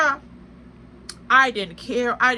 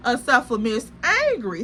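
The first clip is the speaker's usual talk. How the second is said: ordinary delivery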